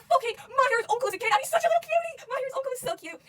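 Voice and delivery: very high, loud squeal; excited gushing. Real, not acted. Fan cooing over the uncle as a kid, high loud squeal. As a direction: squeal over something adorable.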